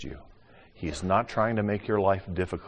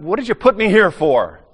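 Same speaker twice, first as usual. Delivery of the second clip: complaining to God; 11 semitones higher, 10 dB louder than usual. Retold or imitated.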